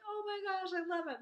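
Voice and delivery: high-pitched thrilled gush; acted delight. Daughter delighted by mom's necklace story, acted delight.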